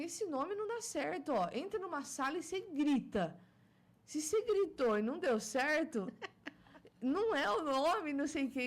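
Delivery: high-pitched, hammed-up film mother; Dona Hermínia. Mother lecturing her daughter about baby names, hammed-up imitation. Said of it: "da dona Hermínia".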